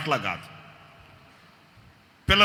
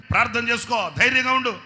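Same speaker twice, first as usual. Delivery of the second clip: higher, louder urging; rousing encouragement; 9 semitones higher, 8 dB louder than usual. Retold or imitated. Pastor urging the sick man to pray and be brave, higher and louder. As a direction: lift his spirits firmly.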